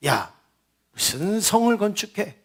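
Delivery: softly voiced scornful jeer; mock scoff. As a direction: scoff under your voice, imitating a mocker. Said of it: "이러면서 막 비웃었다라는 거예요".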